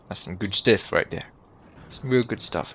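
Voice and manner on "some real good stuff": ordinary delivery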